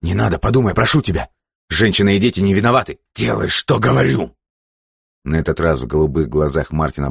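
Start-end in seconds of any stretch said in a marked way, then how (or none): 0.0-3.0 raised, insistent appeal; urgent pleading. Acted. Уэйн умоляет отменить расстрел. 3.1-4.4 high, overriding order; angry command. Acted. Джерри гневно обрывает возражения.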